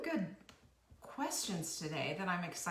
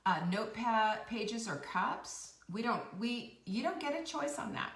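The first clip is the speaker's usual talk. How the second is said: normal talking voice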